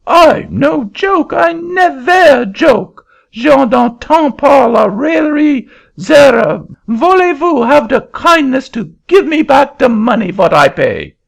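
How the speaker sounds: put-on a Frenchman in a fury in a French accent; furious French accent. Frenchman demanding his money back from the auctioneer, furious French accent.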